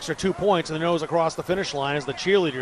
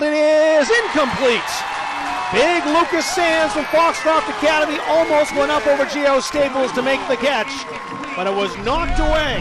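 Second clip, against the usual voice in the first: builds to a loud, higher call on the incompletion; excited play-by-play; 6 semitones higher, 4 dB louder than usual. Real, not acted. Announcer calling a desperate final pass, building excited shout. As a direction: ride the play to its peak, slowing on the throw. Calls the last-ditch pass live.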